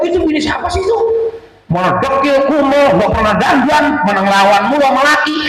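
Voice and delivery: high, sneering gossip voice; whisper-spread scandal, high and snide; gossiping neighbor. Retold or imitated. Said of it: "Nggak sedikit juga orang ngomong".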